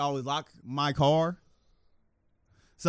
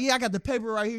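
higher eager voice; lightly voiced eager thief; 8 semitones higher than usual. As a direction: eagerly show the receipt. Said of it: "he's like".

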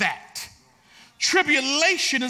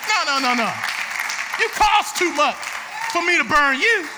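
fiery sermon delivery, much louder; preaching at full force; 3 semitones higher, 4 dB louder than usual. Acted. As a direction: thunder it from the pulpit.

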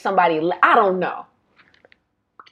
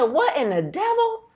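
surprised exclamation; 10 semitones higher than usual. Retold or imitated. Reacting to Gail's red suitcase.

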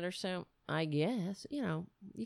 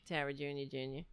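cooing; 5 semitones lower, 5 dB quieter, faster than usual. Real, not acted. Cooing the cat's name.

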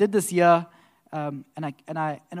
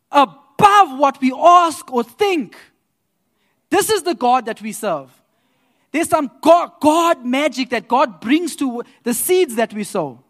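high, loud, slow preacher's cry; full-force preaching; 6 semitones higher, 9 dB louder than usual. Acted. Praising God before the congregation, preaching hard. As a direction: preach it to the rafters.

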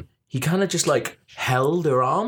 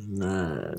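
low teeth-licking noise; 8 semitones lower, 7 dB quieter than usual. Retold or imitated. Imitating the man's teeth licking.

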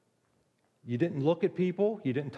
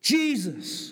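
a pleading cry, pitched up; emotional plea; 6 semitones higher than usual. Retold or imitated. Leper falling down and crying out to Jesus, pleading. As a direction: beg him with feeling in one word. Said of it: "there's a lot of emotion".

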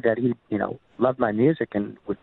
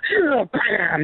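high, punchy "bam"; door-slam imitation; 11 semitones higher than usual. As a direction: burst out with a door-bang noise. Imitates the door banging open.

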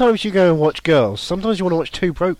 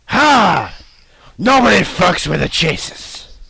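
gruff quote; 5 semitones higher, 6 dB louder, slower than usual. Retold or imitated. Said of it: "He's like".

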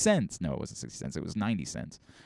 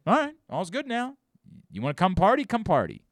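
mock party host; 6 semitones higher than usual. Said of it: "they could say".

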